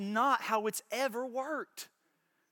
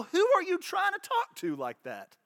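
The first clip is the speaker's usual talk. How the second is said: defiant challenge; 10 semitones higher than usual. Pretend. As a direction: pitch jumps up, scornful and defiant. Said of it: "we're to point at him and go".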